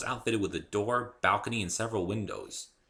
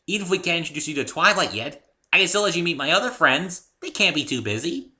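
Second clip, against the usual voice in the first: high, loud eager rush; thrilled; 10 semitones higher, 9 dB louder than usual. A narrator's reading. Pinkie eager to introduce her friends, thrilled. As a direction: bubble over with excitement. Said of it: "looking thrilled".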